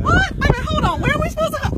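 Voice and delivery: high panicked alarm. Real, not acted. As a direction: pitch shoots way up in alarm. Panics at cliff path.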